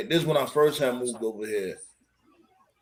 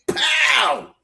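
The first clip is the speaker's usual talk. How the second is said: high, explosive "pow"; imitated impact; 12 semitones higher, 3 dB louder than usual. Dog just came against the gate, high explosive impact noise. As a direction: shout the slam as a sound effect.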